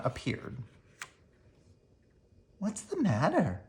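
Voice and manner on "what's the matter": higher, softer motherly voice; gently ask, like a worried mom; concerned mother. Acted. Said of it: "asked Mama Witch".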